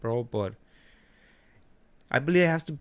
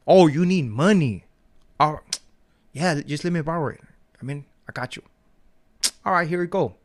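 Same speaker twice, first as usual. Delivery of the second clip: mock eager; 4 semitones higher, 6 dB louder than usual. Mock eager reply to borrower.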